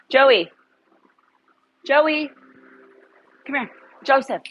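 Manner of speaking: loud, high call; calling name. Real, not acted. Owner calling her dog Joey to come, loud and high.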